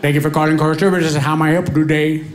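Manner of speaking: lightly voiced call-centre agent, rapid Indian accent. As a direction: greet like an Indian phone agent. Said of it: "customer service representative".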